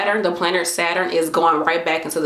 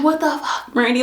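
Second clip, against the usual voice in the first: self-scolding; 8 semitones higher than usual. Retold or imitated. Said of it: "I'm like".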